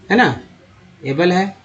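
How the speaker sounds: ordinary talk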